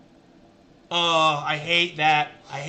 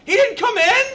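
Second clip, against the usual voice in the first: much higher and louder, rising on didn't; incredulous squeal; 8 dB louder than usual. Real, not acted. Streamer to chat on the second look, squealing incredulous question. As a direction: squeak it out, disbelief peaking.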